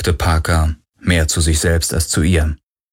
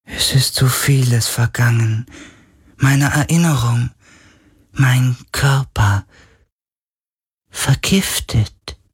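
slow, higher, ghostly lament; sorrowful, drawn-out words; weak spirit voice; 4 semitones higher than usual. A narrator's reading. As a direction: speak as a fading otherworldly being. Weak dying spirit speaks, sorrowful.

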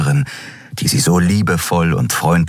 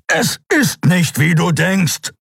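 much higher, slow pleading insistence; urgent plea; 13 semitones higher, slower than usual. Acted. Kero holds Kai and pleads, urgent. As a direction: beg him to understand.

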